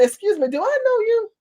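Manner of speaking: high, pointedly polite challenge; mock-polite high question; high-pitched put-down. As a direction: lift the voice high to freeze them out. Putting an intruder in their place.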